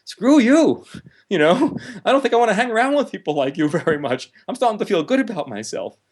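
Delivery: high, bright defiant retort; defiant self-loving part. Retold or imitated. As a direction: push back proudly, voice jumping up high. Self-loving part talks back.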